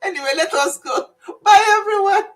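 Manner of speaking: high, loud laughing farewell; laughing goodbye. Real, not acted. Saying bye to viewers through laughter. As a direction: sign off while still cracking up.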